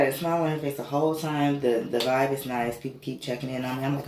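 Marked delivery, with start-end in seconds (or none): none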